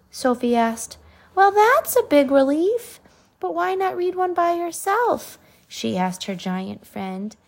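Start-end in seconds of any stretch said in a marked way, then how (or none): 1.3-5.4 high, bright and relieved; cheerful girl voice. Acted. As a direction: sound like a relieved young girl. Sophie relieved.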